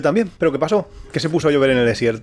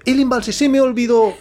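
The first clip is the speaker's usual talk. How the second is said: mock bus driver; 11 semitones higher, 4 dB louder than usual. Driver voiced in retold story.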